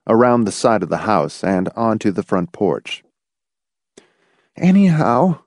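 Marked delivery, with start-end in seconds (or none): 4.6-5.4 high-pitched, shaken woman's voice; shakily. A narrator's reading. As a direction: try to carry on though shaken. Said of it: "Marcia said shakily".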